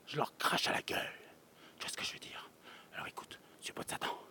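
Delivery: a sinister hushed whisper, half joking; menacing whisper aimed at comedians; mock menacing whisper. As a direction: hiss it, playfully threatening.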